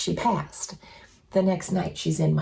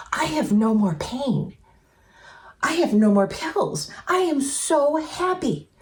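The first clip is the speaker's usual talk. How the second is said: excited joy; 7 semitones higher than usual. Retold or imitated. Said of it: "she was just ha-, so excited".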